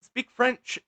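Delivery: loud, high hammed-up command; mock French officer. French airport security to an American traveller, loud mock demand. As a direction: order it as an indignant official. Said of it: "and they're like".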